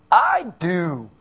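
put-on loud, indignant teen girl; hammed-up defiant outburst. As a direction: blurt it like a stroppy schoolgirl. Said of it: "junior high girl".

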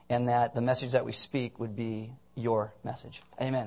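unhurried congregational prayer. Real, not acted. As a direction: address God in measured, subdued phrases.

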